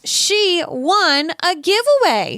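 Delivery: high-pitched winner reveal; excited announcement. Real, not acted. Host names the listener who won the giveaway, excited.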